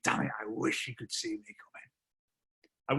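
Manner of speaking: a frustrated thought under your breath; frustrated whisper. Retold or imitated. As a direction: whisper it softly to yourself.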